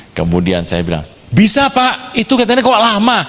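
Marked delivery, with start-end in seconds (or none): 1.3-3.2 high-pitched mock onlooker voice; imitated doubting neighbour. Neighbour questioning the man sawing, high imitated voice. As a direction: pipe up like a nosy neighbour.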